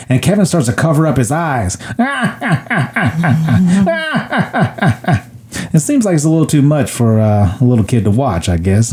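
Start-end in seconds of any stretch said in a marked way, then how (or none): 1.8-5.2 high, staccato maniacal cackle; long mock-villain laugh in repeated bursts; imitated gangster laugh. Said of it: "while he's laughing".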